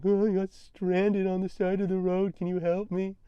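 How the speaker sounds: high, put-on helpless voice; sheepish plea. Stranded driver asking strangers for help, sheepish plea. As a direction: plead in a mock pitiful high voice. Imagined awkward plea to campers.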